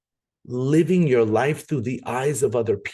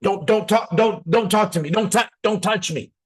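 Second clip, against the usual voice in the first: higher-pitched hurried brush-off; acted refusal to engage; recoiling passer-by; 6 semitones higher than usual. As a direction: fend off a stranger. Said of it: "have people do this. Look at me".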